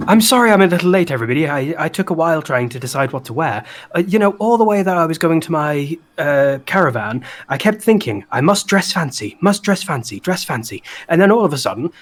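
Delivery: high, fast, giddy cartoon voice; excited party host. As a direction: babble it out, breathless and giddy. Flopsy's voice, excited apology.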